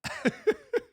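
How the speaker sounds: high squeaky plane noise; a silly high engine squeal; squealing plane sound effect. Acted. Streamer doing a plane, squealing high sound effect.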